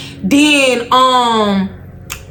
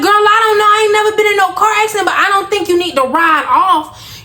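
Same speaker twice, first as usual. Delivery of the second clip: put-on high, fast Girl I don't know; high exasperated disbelief; 8 semitones higher, faster than usual. Telling panicking friend not to flee.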